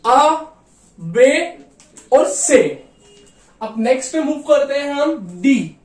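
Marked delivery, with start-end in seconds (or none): 0.1-2.8 आ बे से said as ah, bay, tsay; German letters recap. Shown as a demonstration. Teacher recapping German letters for the class. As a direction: spell out आ, बे, से clearly. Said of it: "German में बोलते हैं से".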